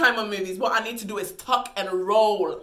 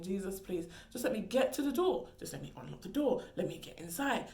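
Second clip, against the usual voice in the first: quiet anxious prayer; 7 dB quieter than usual. Retold or imitated. Walking from the driver to her door, a quiet plea. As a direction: beg quietly to reach the door. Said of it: "I'm thinking".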